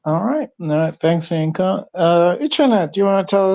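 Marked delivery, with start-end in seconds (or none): none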